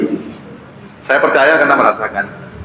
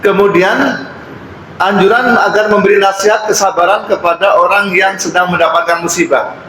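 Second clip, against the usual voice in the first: higher, sustained reading-aloud voice; formal text reading; 8 semitones higher than usual. Acted. Preacher reading a lesson on patience to congregation, formal high recitation. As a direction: read out the teaching point formally, pitched up.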